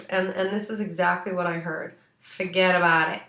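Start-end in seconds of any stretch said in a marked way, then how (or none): 2.2-3.2 loud mock-Italian accent, punched out. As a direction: imitate a brash Italian accent. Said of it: "It was like this Italian accent".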